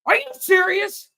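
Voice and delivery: high, loud disbelief; teen stunned at being pulled over; incredulous. Retold or imitated. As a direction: squeak out teenage disbelief. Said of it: "And I was like".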